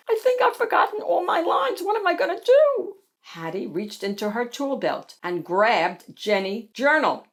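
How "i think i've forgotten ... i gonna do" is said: very high, fast fretting; panicked cry. A narrator's reading. Pearl telling Hattie the lines are forgotten, panicked cry. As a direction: rush it out in a high squeal of panic. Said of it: "Pearl cried".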